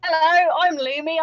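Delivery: usual speaking voice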